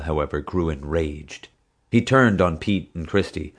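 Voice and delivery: usual speaking voice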